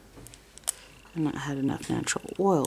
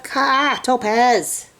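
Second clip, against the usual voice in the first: loud, higher call of the name; calling sharply; 7 semitones higher, 8 dB louder, much slower than usual. Real, not acted. To her cat Topaz, loud sharp call. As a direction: snap the name out to stop her.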